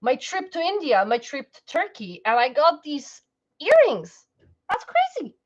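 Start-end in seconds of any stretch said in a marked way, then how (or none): 4.7-5.4 very high excited exclamation; high excited That's crazy; excited squeal. Real, not acted. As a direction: squeal with amazement. Excited about vision board.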